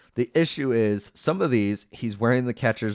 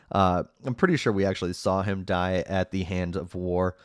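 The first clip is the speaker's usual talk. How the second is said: ordinary talk, nothing marked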